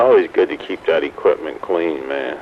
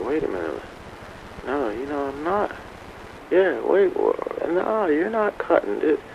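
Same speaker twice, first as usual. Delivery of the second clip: retold confusion; 8 semitones higher, 7 dB quieter than usual. Retold puzzlement while mowing.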